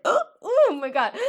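high, loud shocked gasp; imitating a stunned anime hero. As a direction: gasp as if you can't believe it. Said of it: "he's just like".

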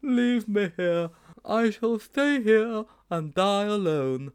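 high, slow, melodramatic surrender; slow high-pitched wail of exhaustion; exhausted gasping. Acted. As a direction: play the dying hero, worn out from running. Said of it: "gasped Sponge".

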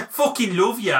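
high, abrasive Brummie; hammed-up Birmingham declaration of love; mock-romantic Brummie squawk; Birmingham accent. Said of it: "Birmingham's so abrasive".

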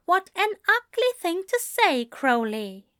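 tut-tut reproach with a smile; chiding. Acted. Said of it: "Aziraphale chided".

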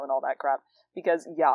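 usual speaking voice

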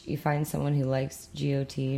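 ordinary delivery

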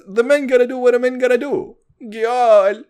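high, fast mock patois lyric; imitating [name]. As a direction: croon it eyes closed, hammed up. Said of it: "He says".

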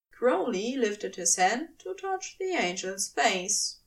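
ordinary delivery

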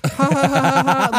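high, loud forced laughter; mock awkward laugh. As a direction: imitate a slow, nervous fake laugh. Retold awkward laugh in the car.

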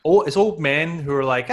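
usual speaking voice

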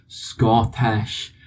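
slow, deliberate Scottish accent. Shown as a demonstration. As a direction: set up a Scottish accent on the word. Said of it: "without saying the word Scottish to, like, reset myself".

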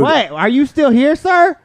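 cashier with attitude. Acted. Co-host as cashier dismissing customer, loud sassy attitude. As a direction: climb in pitch, hammed-up impatience. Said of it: "she had an attitude".

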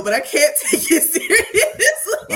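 laughing through the words; can't keep a straight face. Real, not acted. Laughing about The Love Guru.

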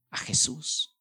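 whisper. Real, not acted. Preacher drops to a whisper.

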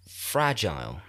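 usual speaking voice

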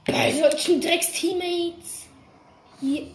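screaming high with fury; slow furious drawn-out scream; berserk rage. Real, not acted. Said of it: "Weil ich raste gleich, glaub ich, aus".

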